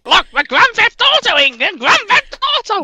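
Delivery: very high, put-on excited voice; hammed-up, high-pitched glee; mock excitement. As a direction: squeal it like an overexcited character. Landlord voice thrilled at the game.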